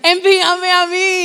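high, drawn-out worship cry; fervor emocionado. Acted. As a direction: imitate an overcome worshipper crying out to God. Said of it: "me emociono". (súplica emocionada, alargada y aguda)

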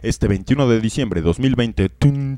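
voz grave y lenta de avance de cine; voz de tráiler de película. Acted. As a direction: anuncia el fin del mundo como en un tráiler. Anuncia el episodio del apocalipsis. (voz épica de tráiler de película)

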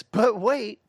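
high, loud infomercial pitchman; Billy Mays pitch. Retold or imitated. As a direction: sell it like a TV pitchman. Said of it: "like Billy Mays says".

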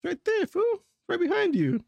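high mocking squeak; teasing high voice. Pointing out the Gatorade to his friend, high teasing squeak. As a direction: tease him in a high voice.

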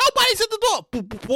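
ordinary delivery

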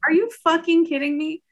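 high, disbelieving outburst; incredulous. Retold or imitated. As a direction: shoot it up in disbelief. Reacting to mom's question.

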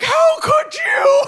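shrieked high and loud, stretched slowly; mock outraged shout. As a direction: screech it like a furious mom. Mom yelling at dad.

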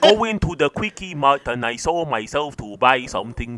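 mock Chinese accent. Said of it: "Okay, yeah, do Chinese".

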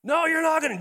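high, loud imitated cry of refusal; mock shout. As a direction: cut him off as the angry lady. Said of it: "that made her mad".

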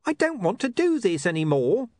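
high, whiny frightened protest; wailing. A narrator's reading. Charlie begging out of the scheme, wailing. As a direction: wail that you want out. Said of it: "Charlie wailed".

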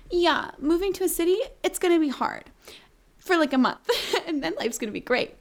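naive optimism. Retold or imitated. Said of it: "I was like".

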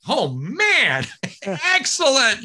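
high, cracking excited cry; high excited shout of joy; thrilled. Retold or imitated. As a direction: squeal with teenage delight. Thrilled teen told he can stay.

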